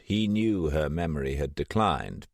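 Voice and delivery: usual speaking voice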